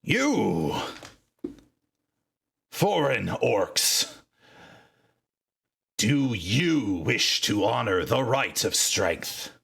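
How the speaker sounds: slow, formal challenge to strangers; lightly voiced elder, grave and measured; solemn orc seer. Seer to the two foreign orcs, slow grave challenge. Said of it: "looks at both of you and says".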